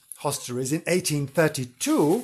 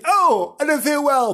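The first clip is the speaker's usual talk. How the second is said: high, louder mock swoon; hammed-up feeble moan; a dying maid's high theatrical gasp; fainting maid; 11 semitones higher, 5 dB louder than usual. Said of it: "and suddenly".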